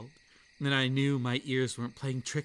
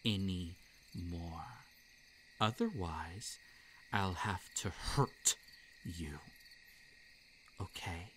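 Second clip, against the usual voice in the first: low quiet threat; 5 semitones lower, 6 dB quieter than usual. Acted. Creature warns the human girl.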